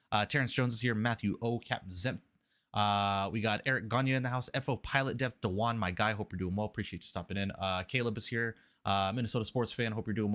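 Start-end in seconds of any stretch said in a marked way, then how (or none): none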